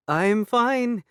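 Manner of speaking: put-on called out in a Turkish accent, high and loud. A narrator's reading. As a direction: fake a Turkish accent calling out. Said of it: "affecting a Turkish accent".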